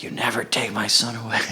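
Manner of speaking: high, hushed mock yell; mock scream. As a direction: imitate an angry mom screaming, without the volume. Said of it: "she was screaming at him".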